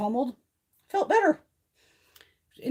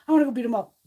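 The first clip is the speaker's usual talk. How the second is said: loud, high mock threat; wound-up comic outburst; retold anger; 5 semitones higher, 7 dB louder, faster than usual. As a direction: relive the irritation, laughing at it.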